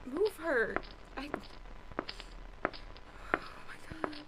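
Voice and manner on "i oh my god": breathless whispered gasp; shocked whisper. Acted. Over the dead woman, a horrified whisper. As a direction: whisper it in horror.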